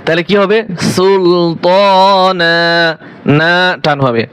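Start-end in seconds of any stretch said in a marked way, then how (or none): 0.9-2.6 সুলতানা with the last আলিফ drawn out slowly at the stop; long final alif. Shown as a demonstration. Teacher showing students how সুলতানা ends at a pause, drawn out. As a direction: stretch the final "না" of সুলতানা to one alif length. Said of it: "এক আলিফ টানিয়া পড়িতে হয়".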